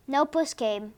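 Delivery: usual speaking voice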